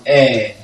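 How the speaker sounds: a long, sustained "air"; long vowel sound. Shown as a demonstration. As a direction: AIR held as one open vowel. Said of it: "the long vowel sound".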